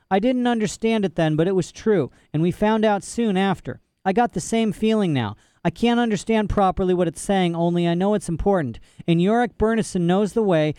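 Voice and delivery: put-on high, eager girl's voice; plead, urging hard; urgent girl. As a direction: Lyra urging Lord Fa.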